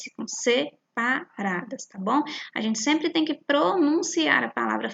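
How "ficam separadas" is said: separadas slowed and chopped, se-pa-radas; broken into syllables. Shown as a demonstration. Teacher explaining vowels that come apart, broken into syllables. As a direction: split separadas with small pauses between syllables. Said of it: "Quando a gente vai separar as sílabas".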